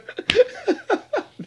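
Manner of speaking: high squeaky laugh; helpless high-pitched laughing; laughter. Real, not acted. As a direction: burst out in high giggles. Laughs at the absurd song.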